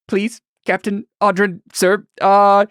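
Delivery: high-pitched grovelling plea, halting then tumbling on; acted pleading of a frightened passenger; desperate begging. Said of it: "kind of prostrates himself before you".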